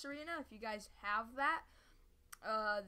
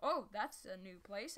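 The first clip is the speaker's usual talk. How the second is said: normal talking voice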